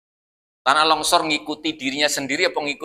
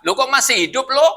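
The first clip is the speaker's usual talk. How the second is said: East Javanese bluntness; 8 semitones higher than usual. Retold or imitated. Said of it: "ngomongnya kelihatannya kasar".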